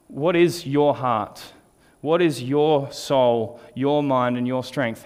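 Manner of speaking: slow, deliberate questions leaning on each YOUR; stress on YOUR. Retold or imitated. Preacher retelling God's questions to him, stressing YOUR. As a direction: draw out YOUR before each noun. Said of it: "I'd never placed the emphasis on the word".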